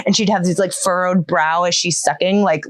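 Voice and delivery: normal talking voice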